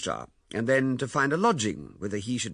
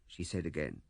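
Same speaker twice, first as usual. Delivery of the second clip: ordinary delivery, nothing marked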